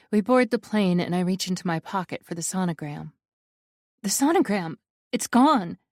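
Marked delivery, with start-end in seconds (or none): 4.0-5.8 panicked cry, louder and higher; a narrator's sudden alarm. A narrator's reading. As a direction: realise it is gone in a rush of panic. Narrator panics over lost sonogram.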